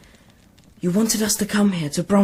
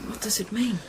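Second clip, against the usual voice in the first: whisper; 3 semitones higher, 5 dB quieter than usual. Acted. Whispering near sleeping monk.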